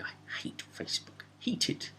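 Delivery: breathy whispered aside, mock secretive. Real, not acted. Whispering his hatred of Facebook to listeners. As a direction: whisper it like a confession.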